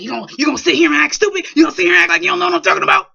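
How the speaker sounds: mock furious dad, fast and loud; angry dad. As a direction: rattle off the accusation like a furious father. Dad confronting him angrily.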